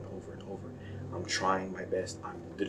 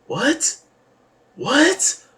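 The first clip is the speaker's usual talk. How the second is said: high loud disbelieving shout; shocked what; 12 dB louder than usual. Real, not acted. Fan seeing Zoro at nine, shocked loud shout. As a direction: yell it in outrage.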